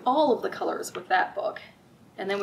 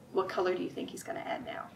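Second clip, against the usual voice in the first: whisper; 3 semitones lower, 8 dB quieter, faster than usual. Real, not acted. Asks children a guessing question.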